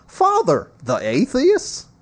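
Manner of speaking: lightly voiced questioner, high on the first word; a hint of a puzzled layman, rising at the end; questioning parishioner. Reader voices someone questioning the Father, lightly acted query.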